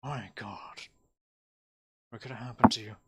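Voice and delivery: lightly voiced mayor taken aback; shocked mayor, hushed. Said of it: "you hear him go".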